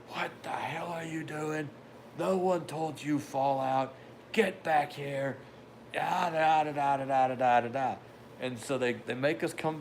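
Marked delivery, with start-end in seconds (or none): 0.1-5.4 mock drill yell. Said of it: "instructors start screaming and yelling". 6.0-8.0 mock ranting gibberish. Imitating instructor's yelling gibberish.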